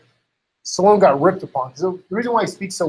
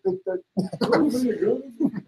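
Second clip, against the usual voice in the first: Stallone mumble impression; 7 semitones higher, slower than usual. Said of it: "He's like".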